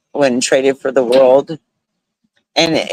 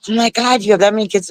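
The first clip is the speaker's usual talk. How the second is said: imitating stunned strangers, pitched up; mock shocked gasp; 8 semitones higher, faster than usual. Strangers marvel at her many kids, mock shock. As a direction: play the gawking stranger, half-mocking. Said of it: "when people are like".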